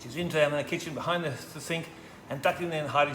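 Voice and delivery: ordinary delivery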